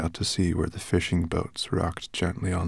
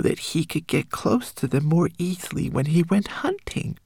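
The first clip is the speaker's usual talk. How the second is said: high, small homunculus voice; a tiny frightened creature warning; 8 semitones higher than usual. Acted.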